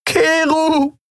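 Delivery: very high, sobbing cry of a name; weeping, coughing woman screaming for him. A narrator's reading. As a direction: scream through tears. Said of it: "Sie weinte, hustete und schrie".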